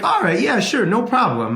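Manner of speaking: high, easygoing yes; breezy agreement. Retold or imitated. Dad casually agreeing to wife.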